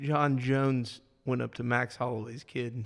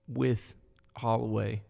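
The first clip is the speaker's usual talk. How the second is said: normal talking voice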